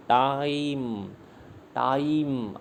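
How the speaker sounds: Time stretched very long on its vowel glide; drawn-out syllable. Shown as a demonstration. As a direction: hold the "ai" of time slowly. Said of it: "आई आ रहा है इसमें".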